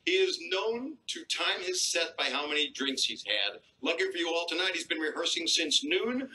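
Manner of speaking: high, booming show announcer calling names; announcer intro. Acted. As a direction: hype up the crowd like a club MC.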